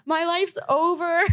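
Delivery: high, slow mock wail through a laugh; dramatic despair. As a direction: overplay the tragedy, laughing. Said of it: "I started crying".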